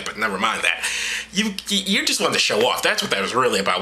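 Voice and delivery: ordinary talk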